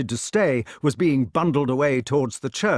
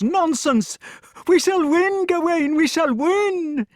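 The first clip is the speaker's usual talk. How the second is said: high, eager king cheering; an old king carried away by the fight; excited old king; 10 semitones higher, 3 dB louder than usual. A narrator's reading. Arthur cheering the battle.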